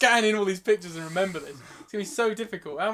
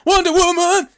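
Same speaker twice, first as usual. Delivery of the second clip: very high, loud superhero cry; hammed-up, piercing hero call; mock heroic shout; 12 semitones higher, 14 dB louder, slower than usual. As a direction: shout the name like a cartoon fanfare. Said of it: "I'll just put that image up and, uh, say".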